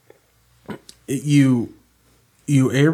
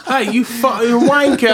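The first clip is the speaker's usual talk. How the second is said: high, loud mock British heckle; hammed-up British insult; English accent; 11 semitones higher, 6 dB louder than usual. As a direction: yell it in a cartoon English accent. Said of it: "English accent".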